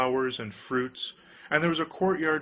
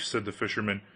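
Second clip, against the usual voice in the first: usual speaking voice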